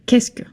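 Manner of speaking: "qu'est-ce que" run together as one word, kess-kuh. Shown as a demonstration. Teacher modelling "qu'est-ce que" as one word. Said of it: "now you can take notes".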